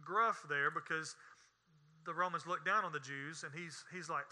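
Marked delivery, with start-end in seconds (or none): none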